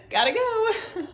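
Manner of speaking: laughing through a quick goodbye. Real, not acted. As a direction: blurt it out while laughing. Laughing at procrastinating gym.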